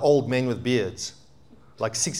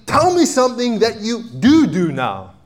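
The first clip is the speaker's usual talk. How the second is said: higher, louder demand; challenging; 9 semitones higher, 6 dB louder than usual. Acted. Preacher challenging believers, high and loud. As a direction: press them to name something.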